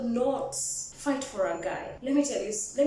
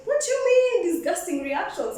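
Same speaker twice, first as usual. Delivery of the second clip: high and louder, offended; indignant retort; 7 semitones higher, 5 dB louder than usual. Retold or imitated. Woman challenging the guy who insulted her, indignant. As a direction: throw his words back at him. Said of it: "I'm like".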